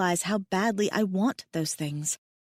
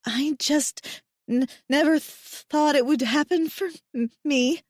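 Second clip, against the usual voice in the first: high, stammering through tears; higher and broken, happy crying; tearful babble; 7 semitones higher than usual. Acted. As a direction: babble it out between sobs. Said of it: "I babble".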